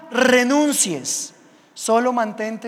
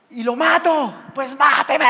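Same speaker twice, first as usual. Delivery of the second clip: retold defiant yell; 5 semitones higher, 4 dB louder than usual. Standoff with the mugger.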